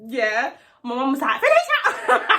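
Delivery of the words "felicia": high, loud screech of the name; mum yelling. Retold or imitated. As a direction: call her out like a furious mum. Angry mum yelling daughter's name.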